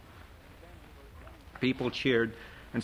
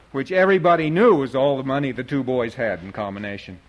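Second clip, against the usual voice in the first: plain reading voice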